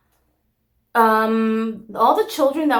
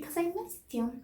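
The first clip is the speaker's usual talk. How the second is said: soft missed you; 9 semitones higher, 12 dB quieter than usual. Acted. Affectionate sign-off to viewers.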